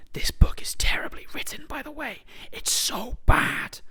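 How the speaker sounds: hushed whisper aside; conspiratorial whisper. Real, not acted. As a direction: whisper it behind your hand. Whispered aside mid-quote.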